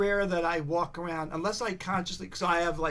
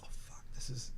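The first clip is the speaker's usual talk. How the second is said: much softer, voiceless mock dismay; hushed dismay; 10 dB quieter than usual. Caller privately giving up on the interview, imitated hushed dismay. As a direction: mutter it under your breath. Imagined inner reaction of caller.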